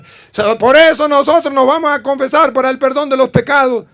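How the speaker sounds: put-on boy proving his point to the bishop; confident child reciting. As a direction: pipe up proudly before the bishop.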